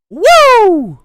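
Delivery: high, loud Ric Flair woo. Retold or imitated. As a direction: belt out a wrestler's woo. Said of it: "Ric Flair theme".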